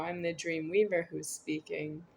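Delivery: ordinary delivery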